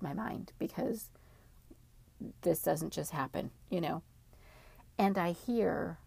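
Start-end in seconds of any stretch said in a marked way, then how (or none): none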